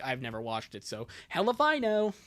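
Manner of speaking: normal talking voice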